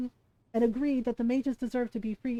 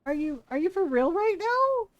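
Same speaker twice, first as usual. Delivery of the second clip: higher, incredulous challenge; exasperated; 6 semitones higher, 4 dB louder than usual. Retold or imitated. Fan confronting Solas in disbelief, exasperated and raised. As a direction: ask it in disbelief. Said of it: "And I'm just like".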